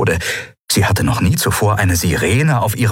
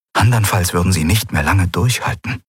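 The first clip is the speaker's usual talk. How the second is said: plain reading voice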